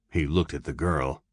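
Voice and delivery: ordinary delivery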